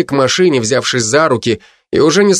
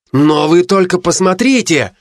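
exclaiming; 7 semitones higher than usual. Acted. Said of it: "воскликнул Крис".

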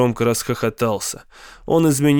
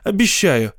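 put-on high-pitched, eager boyish excitement; excited exclamation; 5 semitones higher than usual. A narrator's reading. Boy begs uncle for a dog, excitedly exclaiming. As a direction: promise it eagerly, pitch jumping up. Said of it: "воскликнул я".

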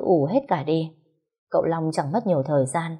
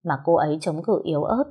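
usual speaking voice

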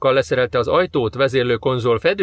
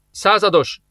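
figyelmeztető kiáltás; 6 semitones higher, slower than usual. A narrator's reading. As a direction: call out a mild warning. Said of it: "jött a figyelmeztető kiáltás". (figyelmeztetően felkiáltva)

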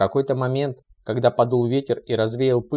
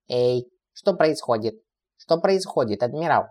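a mild, lightly voiced alarmed shout; understated alarmed call to the admiral. A narrator's reading. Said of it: "Перешёл на крик".

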